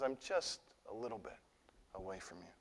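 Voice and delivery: downplay it like Jonah shrugging off his sin; dismissive mock Jonah.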